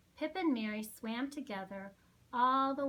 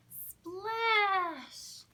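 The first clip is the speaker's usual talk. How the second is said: splash; 8 semitones higher, 5 dB louder than usual. Acted. Pip falls into sea.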